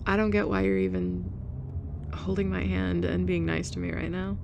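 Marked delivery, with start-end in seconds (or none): none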